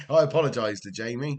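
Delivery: normal talking voice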